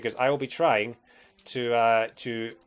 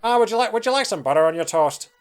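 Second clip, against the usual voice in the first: a hammed-up Northern Irish lilt, much higher and louder; mock Northern Irish accent, pitched up and loud; bad Northern Irish accent; 10 semitones higher, 7 dB louder than usual. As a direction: play a clumsy Northern Irish relative offering toast. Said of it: "the worst Northern Irish accent of all time".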